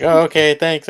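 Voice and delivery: high, hapless thank-you; imitating awkward neighbor. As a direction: pitch it up like a rebuffed nerd. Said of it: "And then he's like".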